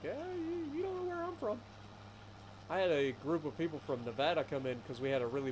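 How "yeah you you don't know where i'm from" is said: mocking retort. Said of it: "I'm like".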